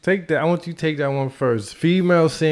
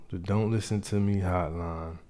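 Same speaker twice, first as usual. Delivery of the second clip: usual speaking voice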